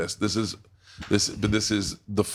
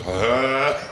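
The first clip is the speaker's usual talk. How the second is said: mock gagging sob; 3 semitones higher, 4 dB louder than usual. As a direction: imitate dad crying and gagging into the curtain. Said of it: "taking turns crying and gagging".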